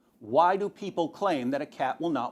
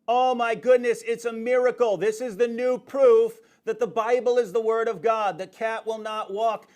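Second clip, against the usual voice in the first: loud, higher hammed-up proclamation of a miracle; mock amazement; 5 semitones higher, 4 dB louder than usual. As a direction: act overjoyed at a fake sign. Parodying a cat miracle claim.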